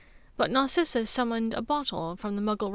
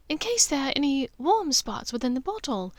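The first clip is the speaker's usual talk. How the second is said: higher motherly voice, kindly teasing her son; Narcissa, gentle mother; 6 semitones higher than usual. Acted. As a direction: play the caring mother checking on her son.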